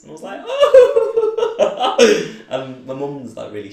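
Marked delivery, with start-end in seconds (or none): 0.5-2.3 very high, loud surprised oh; a burst of happy surprise; delighted gasp. Retold or imitated. Hearing his aunt accept him, a high delighted oh.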